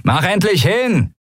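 scarred human fed up with the delay; annoyed call. Acted. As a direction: hurry them up irritably. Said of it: "rief genervt".

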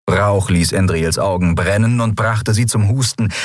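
ordinary delivery

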